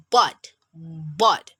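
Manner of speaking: "but" with cheeks ballooning, then released; cheeks bloating. Shown as a demonstration. Teacher showing the letter's bloat and release, cheeks bloating. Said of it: "it should be bloating just like balloon".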